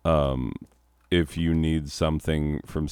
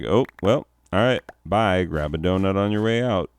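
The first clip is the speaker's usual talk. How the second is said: higher, louder fake-breezy sendoff; mock dismissive; 4 semitones higher, 5 dB louder than usual. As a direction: imitate a boss brushing the staff off. Mocking boss dismissing staff meeting.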